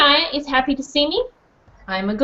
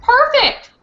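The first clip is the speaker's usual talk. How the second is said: excited; 11 semitones higher, 5 dB louder than usual. Real, not acted. Praising the children's repeats.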